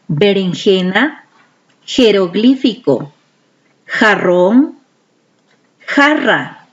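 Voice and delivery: each word said distinctly with long pauses; clear teaching voice. Shown as a demonstration. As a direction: model every word carefully for the kids to repeat. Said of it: "luego de mí lo repiten".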